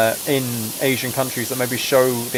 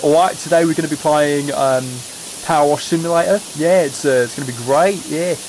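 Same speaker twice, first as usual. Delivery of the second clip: mock Cockney streamer; 2 semitones higher than usual. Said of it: "if I spoke like that for an entire stream".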